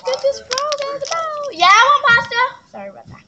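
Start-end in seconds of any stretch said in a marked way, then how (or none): 0.0-1.5 high, loud Glozell shout; Glozell quote. Retold or imitated. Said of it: "when Glozell said". 1.5-2.4 loud high call across the house. Real, not acted. Calling out to family.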